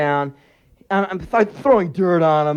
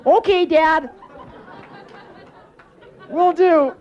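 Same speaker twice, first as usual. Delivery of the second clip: put-on high-pitched kid answering up to Dad; little kid voice; slower than usual. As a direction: pipe up like a dutiful little boy.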